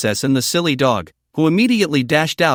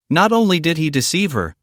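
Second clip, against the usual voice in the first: ordinary delivery, nothing marked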